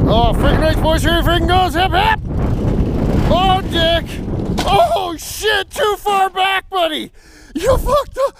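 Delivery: high-pitched excited hollering at the tractor; squealing play-by-play as it takes off; excited cheering. Real, not acted. Cheering the second run.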